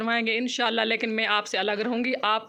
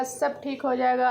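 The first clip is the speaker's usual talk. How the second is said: normal talking voice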